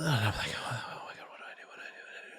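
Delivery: hushed, frantic inner voice, imitated; whispered panic. Filmer dithering before the first punch, retold in whispered panic. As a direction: mutter it under the breath, fading away.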